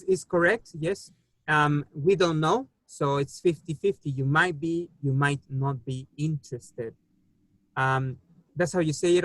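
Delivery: ordinary talk, nothing marked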